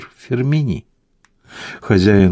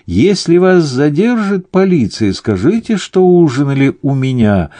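high-pitched call after departing guests; raised, urgent calling voice; shouting after; 8 semitones higher, 4 dB louder than usual. A narrator's reading. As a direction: call instructions out to people walking away. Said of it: "закричал хозяин им вслед".

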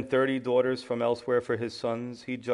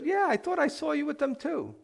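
very high-pitched mock bystander; put-on high voice of recognition; second accuser; 14 semitones higher than usual.

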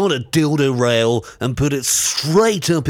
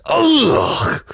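disgusted groan; 8 semitones higher than usual. Acted. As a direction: spit out a revolted ugh. Disgust at caller.

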